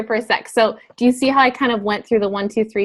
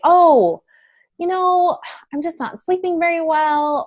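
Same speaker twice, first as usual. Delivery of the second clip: much higher, hesitant customer voice; imagined customer reply; 8 semitones higher, slower than usual. Acted. Customer answering about health concerns, high-pitched reply. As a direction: play a customer admitting a small complaint, pitched up. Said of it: "they're gonna say".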